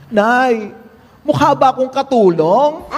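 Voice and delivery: a hint of a child pleading with mom. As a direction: ask it as a worried kid. Child asks in joke.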